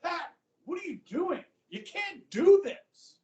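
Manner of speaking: high-pitched exasperated reproach; Eisenhower. Retold or imitated. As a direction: scold a friend, pitch jumping way up. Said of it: "Eisenhower finds out about this, and he's like".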